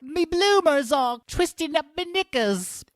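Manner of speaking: very high, slow mock Cockney; terrible high Cockney accent, drawn out. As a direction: squawk it as a cheeky Cockney caricature. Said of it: "[name] has a Cockney accent".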